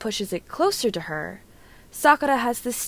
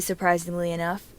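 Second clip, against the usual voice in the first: plain reading voice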